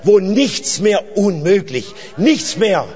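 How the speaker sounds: loud, high-pitched proclamation to the congregation; fervent preaching. Real, not acted. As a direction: proclaim it with rising fervour, leaning on key words. Preaches at full force.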